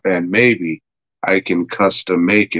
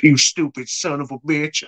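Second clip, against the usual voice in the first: attempted Southern accent; 6 semitones higher than usual. Storyteller imitates the cop insulting Davey, attempted Southern accent. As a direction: try a weird Southern accent. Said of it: "like, a weird Southern accent all of a sudden".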